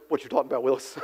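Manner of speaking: low, soft mock sitcom catchphrase again quoted deadpan; eighties catchphrase. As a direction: murmur the famous catchphrase low and dry. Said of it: "to use another eighties catchphrase".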